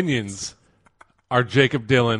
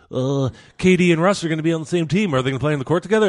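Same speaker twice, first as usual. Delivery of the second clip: high, excited fan gushing; 7 semitones higher, 4 dB louder, faster than usual. Retold or imitated. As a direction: ask it like an eager fan. Mimics hyped fans.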